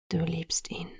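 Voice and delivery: soft, low, tender near-whisper; gentle. A narrator's reading. Boyfriend names her love for his rival, gently. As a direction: state it softly, with no reproach. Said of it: "sagte er sanft".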